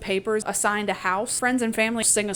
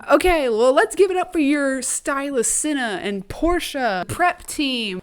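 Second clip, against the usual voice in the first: raised, loud host introduction; show host; 5 semitones higher, 5 dB louder than usual. Acted. Caesar calling for applause for the stylist, show host. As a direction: announce them to the crowd like an emcee.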